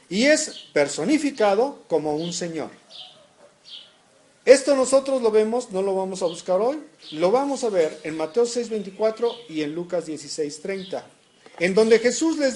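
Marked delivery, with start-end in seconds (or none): none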